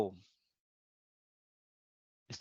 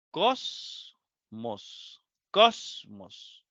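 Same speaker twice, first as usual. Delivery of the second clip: Cosmos drawn out slowly, syllable by syllable; slow syllable demo; 4 semitones lower, much slower than usual. Shown as a demonstration. Teacher spelling out a Greek word for the class, slow and deliberate. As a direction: say Cosmos slowly, stressing cos. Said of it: "Dos sílabas".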